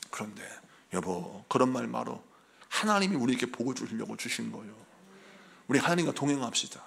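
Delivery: low, soft reassuring husband; calm your worried wife; gentle husband. Retold or imitated. Husband softly reassures his worried wife.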